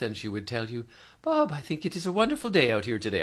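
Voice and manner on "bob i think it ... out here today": put-on high, lilting French-Canadian accent, half laughing; Jocelyn's French accent. Host imitates late golfer greeting him, laughing French accent. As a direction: imitate a gentle French-Canadian lady's lilt, much higher. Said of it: "beautiful lilt in her voice, a little bit of the French accent".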